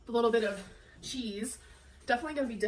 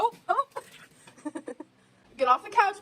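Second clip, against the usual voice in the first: squealing at the dog; 9 semitones higher, 3 dB louder than usual. Real, not acted. Dog jumps on couch.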